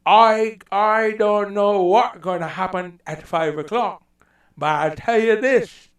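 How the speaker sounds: a rough try at a Bajan voice; Barbadian accent. Explaining the Caribbean accent, a loud rough Barbadian accent. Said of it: "there's a Barbadian accent, which I can't do really well".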